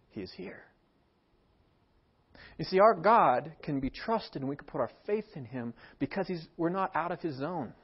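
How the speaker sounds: ordinary talk, nothing marked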